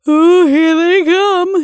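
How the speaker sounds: high, loud, drawn-out wail; terrified wail. A narrator's reading. Grisby sees the monsters coming, terrified wail. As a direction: wail it slowly in terror. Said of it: "gave a little gurgle".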